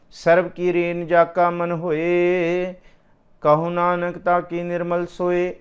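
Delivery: loud, very slow, drawn-out verse; slow scripture recitation. Acted. Preacher reciting Gurbani to the congregation, slow intoning. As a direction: intone each word of the verse slowly.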